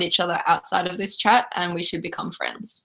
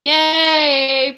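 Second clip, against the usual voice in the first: excited cheer; 8 semitones higher, 7 dB louder than usual. Real, not acted. Cheering about a fellow Australian.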